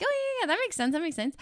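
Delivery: high-pitched, over-agreeable nodding along; feigned understanding. Retold or imitated. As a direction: pretend it all makes sense.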